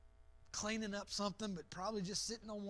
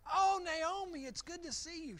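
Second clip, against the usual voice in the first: glad greeting; 7 semitones higher, 4 dB louder than usual. Acted. Townsfolk greet Naomi gladly.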